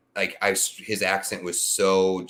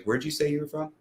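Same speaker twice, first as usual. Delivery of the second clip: puzzled question; 7 semitones higher, 4 dB quieter than usual. Retold or imitated. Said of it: "I was like".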